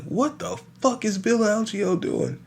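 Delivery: high, baffled, under the breath; can't believe what he's watching; high-pitched disbelief; incredulous. Retold or imitated. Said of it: "I was like".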